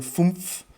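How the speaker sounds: imitating a German "fumpf" with a clipped final f; mock German saying "fumpf"; German fünf. Imitating German speakers to show the language doesn't sound strong. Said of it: "when you hear German guys saying".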